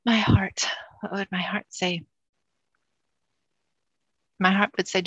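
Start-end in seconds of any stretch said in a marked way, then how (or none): 0.0-2.0 hushed, half-whispered pondering; musing to herself. Real, not acted. Client searching her heart for an answer, hushed musing. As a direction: think aloud, barely voiced.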